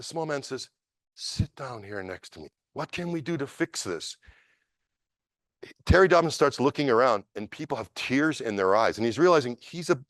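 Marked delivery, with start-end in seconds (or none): 1.1-4.2 comforting a broken man beside you; quiet kindly old master; tender invitation. Retold or imitated.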